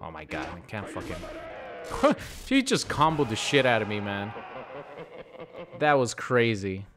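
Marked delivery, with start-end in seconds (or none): 1.2-2.4 high, breathy burst of laughter; disbelieving laugh. Real, not acted. Gamer just comboed by opponent, high incredulous laugh. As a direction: laugh out in shock at the beating.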